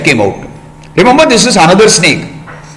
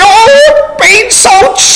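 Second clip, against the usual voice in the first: cry of pain; much slower than usual. Acted. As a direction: yelp as if just bitten. Boy bitten by the ant.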